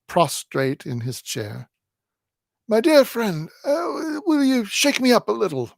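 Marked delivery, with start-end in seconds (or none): 2.7-5.7 put-on high, breathless old-man plea; a squashed old man asking for help; high feeble old-man voice; old man Smallweed. A narrator's reading. Old man begs to be shaken.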